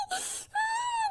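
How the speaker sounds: high sobbing wail cut short; a short sobbing shriek; crying wail. Acted. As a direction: let out a high, choked cry for help. Girl cries out for help.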